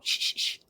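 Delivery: three short whispered shh sounds; very quiet breathy shushing; mom's soft hush. Retold or imitated. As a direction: hush a baby softly, shh, shh, shh. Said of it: "She'd, like, go".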